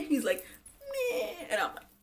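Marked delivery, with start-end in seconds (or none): 0.8-1.5 very high bleat; mock whimper. Jesse hugging her back, mock whimper. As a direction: squeak it back in imitation. Mimicking his hug noise.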